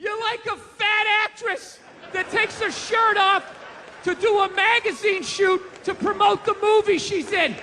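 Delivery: high, loud, gushing praise; mock gushing. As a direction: gush over her like a hero. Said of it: "Am I gonna sit there like".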